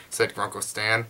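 usual speaking voice

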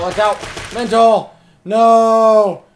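loud, high panicked yell to a teammate; urgent high shouting mid-game; real warning shout. Real, not acted. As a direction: yell the warning at full volume.

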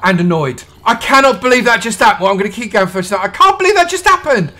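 exasperated, high-pitched self-scolding; kicking yourself out loud; sad and annoyed. Real, not acted. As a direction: rise into loud, dismayed disbelief. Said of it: "I'm really sad and annoyed".

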